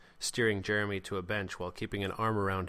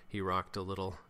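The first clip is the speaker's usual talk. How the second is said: plain reading voice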